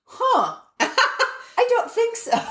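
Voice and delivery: high, slow answer through laughter; amused retold doubt. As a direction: act out a doubtful reply, laughing. Retold reaction to pink question, laughing.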